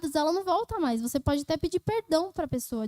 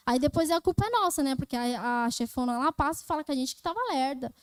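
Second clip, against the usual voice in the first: ordinary delivery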